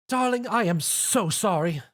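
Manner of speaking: drawn-out heartfelt apology; apologetic Rarity. Acted. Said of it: "Rarity apologized".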